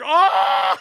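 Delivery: a loud, high burst of delight; an "Oh!" belted out loud and high; thrilled exclamation. Retold or imitated. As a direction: cry out like the page just blew your mind. Reader's reaction to the panel.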